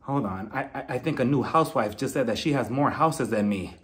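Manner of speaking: low, soft, groggy realization with long pauses; Giselle just waking. Retold or imitated. Housewife waking to news of a rival's houses, groggy disbelief. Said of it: "Giselle was probably sleeping in Potomac like".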